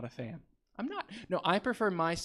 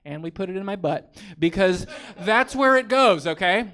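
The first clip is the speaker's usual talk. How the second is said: mock indignant; 4 semitones higher, 9 dB louder than usual. Real, not acted.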